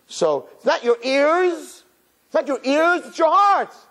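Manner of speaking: high, slow astonishment; awed wonder. Retold or imitated. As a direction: marvel slowly, as if hearing a roar. Climactic realization.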